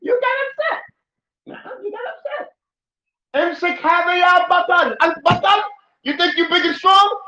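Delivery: high, loud, taunting; loud mock taunt at the angry man; mocking challenge. Shown as a demonstration. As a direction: goad him as if daring him.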